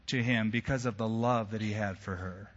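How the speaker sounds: low, soft romantic reading; hushed, sentimental tone; tender. Real, not acted. Tender romantic verse, then aside.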